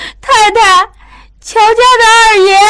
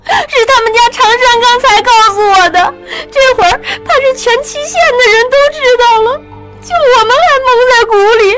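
put-on high, sobbing, rushed girl's voice; crying while speaking; faster than usual. A narrator's reading. Maid sobs out what Changshan told her. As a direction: weep as you tell it. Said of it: "翠儿边哭边点着头说".

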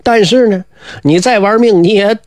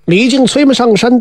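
usual speaking voice

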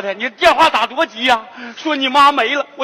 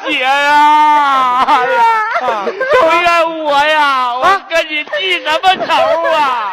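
theatrical wailing; slower than usual. Acted. Brother grieving over his sister, theatrical wailing. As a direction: wail with remorse, stretching each cry. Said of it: "哥，别哭了".

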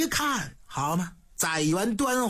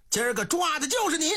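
rough arrest shout; 6 semitones higher, much faster than usual. A narrator's reading. Soldier seizes the official.

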